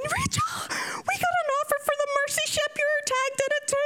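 very high-pitched excited squeal; squealed at the top of the range; mock scream. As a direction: imitate a friend shrieking big news. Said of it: "she was screaming".

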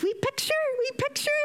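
high, sing-song coo over the picture. Retold or imitated. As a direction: coo like at a baby. Cooing at happy patient.

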